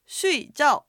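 声調を間違えた水餃; wrong tones. Shown as a demonstration. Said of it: "アクセントを違って".

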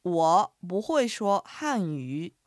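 slow, careful echo of the phrase; slow learner-style repeat. Shown as a demonstration. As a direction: repeat slowly after the teacher. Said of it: "発音と合わせて言ってみましょう".

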